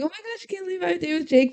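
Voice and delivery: high, very fast excited gush. Retold or imitated. Savannah hears about her date with Jake, acted excited gush. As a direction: rush it out in a high-pitched mock flurry. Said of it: "like, so excited".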